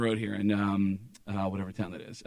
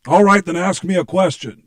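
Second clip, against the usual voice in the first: loud, higher, brash challenge; brash demand; 4 semitones higher, 8 dB louder than usual. Retold or imitated. Prank caller challenging the assistant, brash demand. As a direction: push it out like a cocky star.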